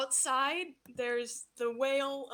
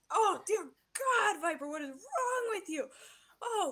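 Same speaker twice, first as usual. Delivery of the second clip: wailing villain; 7 semitones higher than usual. Acted. Pepper-sprayed villain wailing at Viper, high and pained. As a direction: whine in pain and outrage. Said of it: "he says".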